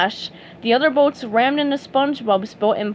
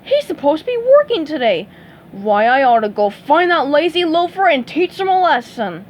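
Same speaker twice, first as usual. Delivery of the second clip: louder, higher, quick grumble; annoyed boss; 5 semitones higher, 5 dB louder than usual. Acted. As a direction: fume about the employee.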